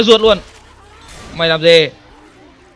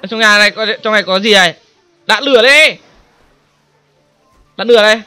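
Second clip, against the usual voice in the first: ordinary talk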